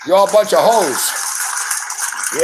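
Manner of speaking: very slow, drawn out taunt; slow mock taunt. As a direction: drag it out to tease. Mocking audience, very slow.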